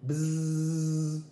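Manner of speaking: buzzing like a little fly; a fly buzzing from above, in a buzzy voice. Acted. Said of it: "He heard a voice from above".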